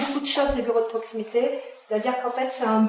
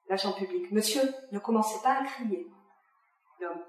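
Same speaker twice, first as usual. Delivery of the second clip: ordinary talk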